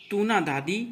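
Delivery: syllables run together quickly; quick, flowing tabla syllables; fast recitation. Shown as a demonstration. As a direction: rattle the bols off at speed. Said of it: "तीव्र गति से पढ़ेंगे".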